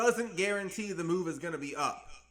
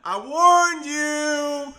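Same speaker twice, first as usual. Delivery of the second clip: mock warning; 5 semitones higher, 10 dB louder, much slower than usual. Real, not acted. Hyped streamer teasing chat.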